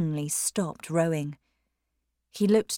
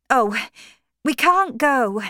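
hurried protest; 7 semitones higher, 7 dB louder than usual. Acted. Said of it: "said Maggie quickly".

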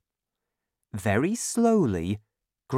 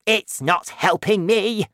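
raised, high protesting grandma; shouting; 6 semitones higher, 4 dB louder than usual. A narrator's reading. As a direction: protest loudly in a higher voice. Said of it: "Grandma shouted".

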